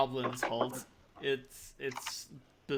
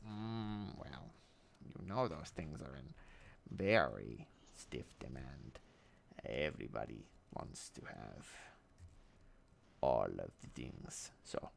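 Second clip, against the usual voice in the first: much lower, drawling merchant voice; deep goblin voice; 14 semitones lower, 12 dB quieter than usual. Acted. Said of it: "one of the Daves just says".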